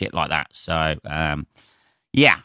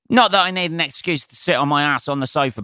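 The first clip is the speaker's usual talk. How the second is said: ordinary delivery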